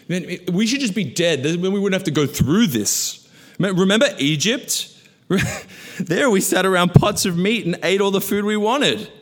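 high, loud theatrical moaning; whiny complaining Israelites. Acted. Preacher voices the Israelites whining to Moses, high and loud. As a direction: ham up the grumbling crowd.

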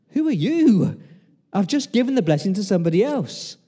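high, loud, startled question; acted astonishment, voice shooting up; shocked father. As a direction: blurt it out in alarmed disbelief. Isaac shocked at the real Esau.

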